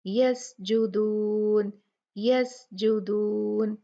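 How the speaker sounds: यस जुदून slow, the s of यस hissed with audible air; slow यस जुदून with a long airy s; breathy hams. Shown as a demonstration. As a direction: let the air escape on the s of यस. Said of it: "हम्स की जो इसकी सिफ्त है उसको निकालेंगे".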